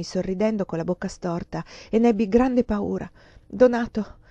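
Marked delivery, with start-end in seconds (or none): 3.5-4.2 slightly raised, uneasy call; frightened stammer. A narrator's reading. Countess facing the man she fears, frightened stammer. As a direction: utter the name with timid alarm.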